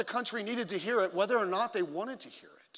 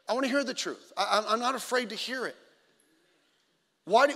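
ordinary delivery

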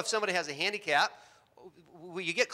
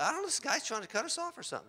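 higher and quick, faking concern; mock worried driver; 9 semitones higher, 9 dB quieter, faster than usual. Driver faking worry to the blind man, quick and higher. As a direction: sell the fake danger fast.

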